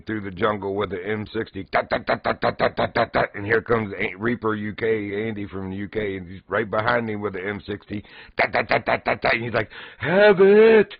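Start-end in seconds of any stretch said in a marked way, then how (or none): 1.6-3.3 higher, louder mock gunfire tuts; machine gun noises. Imitating a friend's M60 firing in the jungle. 8.4-9.3 higher, louder mock gunfire tuts; machine gun noises. Imitating a friend's M60 firing in the jungle. 10.0-10.9 much higher and louder battle yell. Retold or imitated. Reaper UK Andy yelling over TeamSpeak while mowing down soldiers. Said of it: "Just yelling over TeamSpeak".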